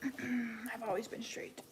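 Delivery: slow breathy whisper for a joke; mock secret whisper. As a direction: drop to a slow whisper as if confessing. Joking answer to chat question.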